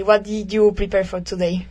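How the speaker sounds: ordinary talk, nothing marked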